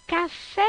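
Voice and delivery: café called out, final FÉ long and high; stress on FÉ. Shown as a demonstration. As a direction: stretch and lift the FÉ in "Café". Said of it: "é o fé". (café com o FÉ final longo e agudo)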